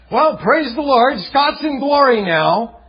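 high, loud grinning praise; mock the grinning pastor's chirpy praise; silly cheerful pastor. Retold pastor chirping at a grieving widow, high and loud. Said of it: "came bounding up with this silly grin on his face and said".

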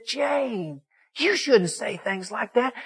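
high, softened appeal, acted out; pleading reproach. Preacher tries to calm angry Jane, pleading. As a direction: gently chide her in a high, pleading voice.

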